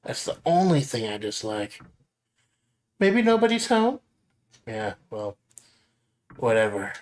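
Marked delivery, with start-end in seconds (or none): 3.0-4.0 high-pitched Nami, hushed and curious; high girl voice. Acted. Nami asks her friend about a rustling noise, high girl voice.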